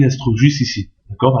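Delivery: usual speaking voice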